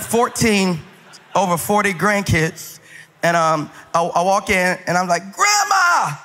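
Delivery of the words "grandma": calling out. Retold or imitated. Grandson calling out to half-blind grandma.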